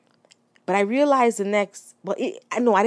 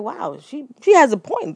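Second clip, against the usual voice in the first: sudden realization; 8 semitones higher, 5 dB louder than usual. Retold or imitated. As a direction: voice jumps up high and loud on has a point. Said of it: "but then I was like".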